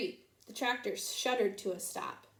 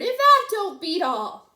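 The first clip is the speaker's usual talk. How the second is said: loud, slow, higher exclamation; blabbering; 8 semitones higher, 13 dB louder than usual. A narrator's reading. Deputy Bob blabbers at the sight, loud and slow. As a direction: blurt it out amazed. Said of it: "Deputy Bob blabbered".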